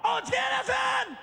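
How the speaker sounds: high, shouted welcome; excited partygoers. Retold or imitated. Partygoers hailing the celebrity, high excited shout. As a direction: squeal out the star's arrival. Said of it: "they go".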